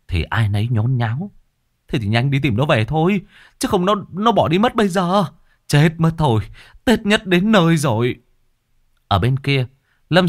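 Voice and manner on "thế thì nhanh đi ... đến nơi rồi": higher, anxious chatter; lightly voiced village neighbour; worried neighbour. A narrator's reading. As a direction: fret about the lost son.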